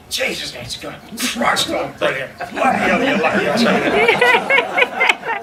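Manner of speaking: hammed-up smug dentist, laughing through it; self-praising dentist. Johnny admiring his finished work, hammed-up smug laughing voice. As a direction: crow about your own work as a laughing joke. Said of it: "he'd look back and go".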